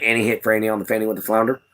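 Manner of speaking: fast tongue-twister, rattled off; said really quick. Shown as a demonstration. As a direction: rattle it off fast so it sounds right. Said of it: "you gotta say really quick".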